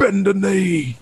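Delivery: high laughing Scouse "Bend the knee"; mock northern English command; Liverpool accent. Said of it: "Nice, nice. I love it".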